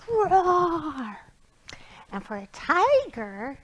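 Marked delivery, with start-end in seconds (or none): 0.1-1.2 demo lion roar. Shown as a demonstration. Teacher showing children a lion roar, playful roar. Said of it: "you get to roar".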